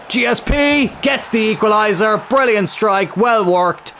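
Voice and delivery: high excited shout for the equaliser; goal shout. Real, not acted. Team scores the equaliser.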